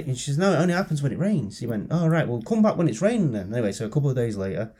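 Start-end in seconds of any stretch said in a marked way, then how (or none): 0.4-1.6 lightly voiced higher-pitched patient; mild mock protest, pitched up; insistent patient. Joke's patient corrects her doctor, lightly acted insistence. 1.9-3.5 lightly voiced doctor, pitched higher; dismissive doctor. Joke's doctor sends patient away, mildly put-on higher voice.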